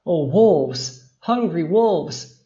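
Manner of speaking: a child's scream, acted with some restraint; shrill scream. A narrator's reading. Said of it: "screamed Andrina in her thin and shrill voice".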